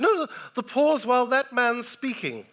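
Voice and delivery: high, loud mock actress voice; imitating the actress. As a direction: squeak it out like an anxious actress. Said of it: "He said".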